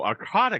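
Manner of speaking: Arcata with an "ah" in the middle, ar-KAH-tuh; mispronounced Arcata. Real, not acted. Host misreading the guest's hometown, Arcata as ar-KAH-tuh. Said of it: "It's actually pronounced Arcata".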